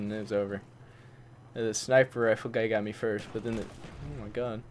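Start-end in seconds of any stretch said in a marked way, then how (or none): none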